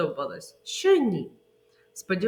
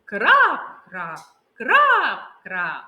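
loud drip sounds; 7 dB louder, much slower than usual. Shown as a demonstration. Teacher showing loud rain to children. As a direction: make the rain pound. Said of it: "Голосніше і тихіше".

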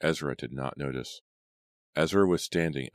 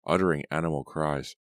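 plain reading voice

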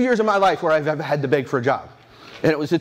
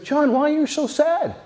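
much higher, lightly voiced kind friend; a hint of a caring friend at a conference table; concerned Adam; 10 semitones higher than usual. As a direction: ask gently, pitched up high.

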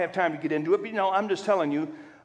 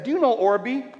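cheeky comeback; 6 semitones higher than usual. Retold or imitated. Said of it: "I said".

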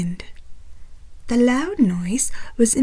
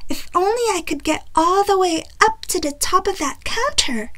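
put-on higher, louder little girl voice; a greedy little girl eyeing dessert; Goldilocks; 8 semitones higher, 4 dB louder than usual. A narrator's reading. Goldilocks longing for the pudding on the counter.

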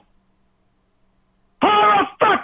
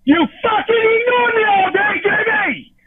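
yelling at Jamie through tears; furious crying shout; 2 semitones higher than usual. Real, not acted. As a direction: scream it through tears at her.

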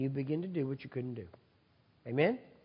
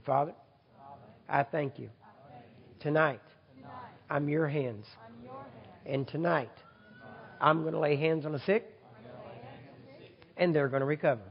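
leading prayer. Real, not acted. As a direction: pray it out with conviction.

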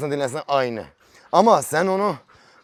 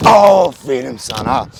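slow, stretched praise to a pet; drawn-out praise; 5 semitones lower, 7 dB louder, much slower than usual. Real, not acted. Praising the bird, slow and drawn out. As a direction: draw out every syllable of the praise.

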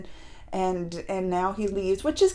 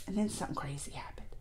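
fast hushed whisper; quick unvoiced whisper teasing a twist; suspense whisper; 11 semitones lower, 21 dB quieter, faster than usual. Real, not acted. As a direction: whisper it like a juicy secret. Building suspense in recap.

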